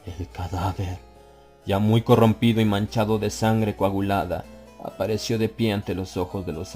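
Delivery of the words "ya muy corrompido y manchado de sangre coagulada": ordinary delivery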